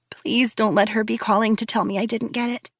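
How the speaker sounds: ordinary delivery, nothing marked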